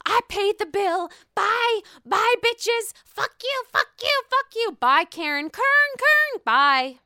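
high, loud shrieking goodbye; hammed-up furious exit; mock screaming Karen. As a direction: shriek it like a diva storming out. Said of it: "She says".

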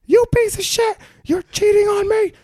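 high, loud furious screech at him; angry yell. Retold or imitated. Girlfriend yelling at cheating boyfriend, high furious screech. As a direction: scream the accusation like a betrayed girlfriend.

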